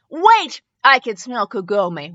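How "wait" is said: sudden loud, higher shout; angry yell. Acted. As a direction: bark it out angrily. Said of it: "the angry male yell".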